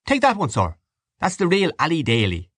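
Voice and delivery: high, hearty shopkeeper selling a bird; a lightly voiced Dublin poulterer; remembered shopman praising the turkey; poulterer's voice. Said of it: "he remembered the man's voice".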